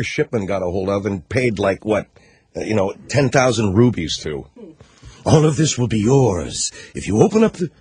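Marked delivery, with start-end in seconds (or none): 5.2-7.7 lightly voiced bazaar tempter; mock exotic tempter. Imagined bribe with rupees and diamonds.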